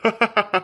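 short gloating laugh. Acted. Child delighted at the cousin's broken arm, gloating laugh. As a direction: laugh at someone's misfortune.

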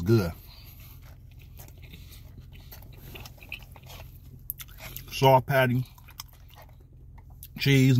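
talking between bites; chewing while describing food; mouth full, chewing. Real, not acted. Seen 2: Bites burger at -4, mouth still working food while speaking early on.

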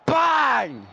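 sudden high shout of bang. Real, not acted. Gamer yelling at his own tackle, sharp high shout. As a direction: blurt it out like a punch landing.

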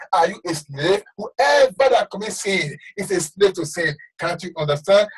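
ordinary talk

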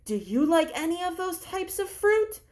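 ordinary delivery

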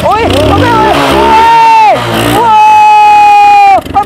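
high, loud whoops of astonishment; real excited shouting. Real, not acted. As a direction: yell out in amazed triumph, stretching the cries. Bike starts on deodorant spray.

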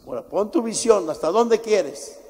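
rousing pulpit challenge; preaching call. Real, not acted. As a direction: push them to name their goal.

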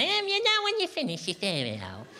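put-on high, sugary voice explaining to a small child; mock-patient nursery voice for the housemates; sing-song voice for housemates. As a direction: talk down to them like a nursery teacher. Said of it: "Why are you talking like that".

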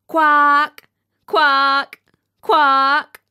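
three big honking quacks in a duck's voice; duck quacking. A narrator's reading. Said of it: "she said".

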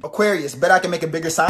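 ordinary delivery

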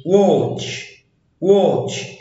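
"watch" with the A said as O; A pronounced O. Shown as a demonstration. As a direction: say watch twice as a model with an O vowel. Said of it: "اللي هنا اتنطقت O".

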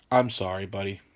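normal talking voice

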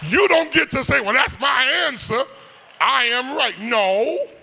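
loud, high sung whoop of a preacher; climbing sung sermon whoop; preaching at full force. Acted. Mocking quote then no.

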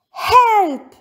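high, loud call for help; shouted cry for help. A narrator's reading. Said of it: "shouts Poppy".

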